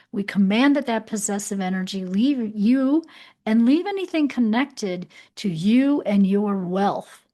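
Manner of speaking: forceful loud command. Real, not acted. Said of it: "I ask it to leave very strongly".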